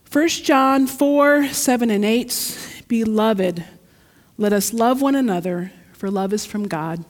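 formal Bible reading; scripture reading. Acted. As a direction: read the verse out with weight. Reads scripture aloud.

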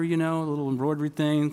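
ordinary talk, nothing marked

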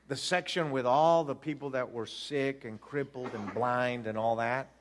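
usual speaking voice

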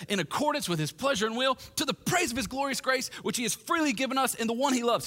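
high-pitched, exultant reading; praise break. Acted. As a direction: lift the verse into a burst of praise. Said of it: "he hits a praise break here".

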